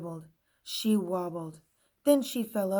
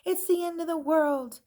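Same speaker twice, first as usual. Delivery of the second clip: high, louder panic; panicking pencil; 9 semitones higher, 7 dB louder than usual. A narrator's reading. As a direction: wail of doom.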